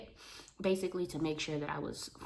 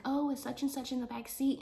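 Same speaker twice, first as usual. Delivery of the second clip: excited discovery; 9 semitones higher than usual. Retold or imitated. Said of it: "and I was like".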